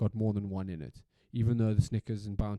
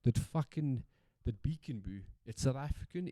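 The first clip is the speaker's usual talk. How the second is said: normal talking voice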